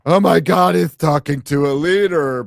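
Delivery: high, loud shrill-mocking outrage. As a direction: mimic the pearl-clutching critics.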